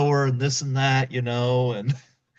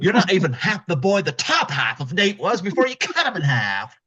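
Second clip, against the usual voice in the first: berating father; 6 semitones higher than usual. Retold or imitated.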